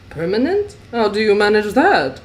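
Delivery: honest curiosity. Acted. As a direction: pitch it up in curious surprise. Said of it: "There was honest curiosity in his voice".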